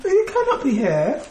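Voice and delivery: put-on high, laughing Caribbean accent. Repeating the put-down in a high mock Caribbean accent. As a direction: tease her in a playful Caribbean accent. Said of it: "by the way you were doing that accent".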